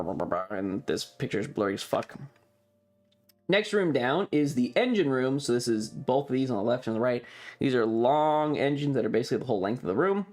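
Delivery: normal talking voice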